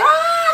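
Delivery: loud, very high whoop; sudden shrieking mock guffaw; mock burst of laughter. Imitates Pauline bursting out laughing.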